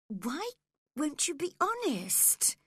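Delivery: put-on high, indignant girl's voice; crying out. A narrator's reading. Cathy demands honesty from Linton, indignant cry. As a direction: cry it out in high-pitched reproach. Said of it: "cried Cathy at once".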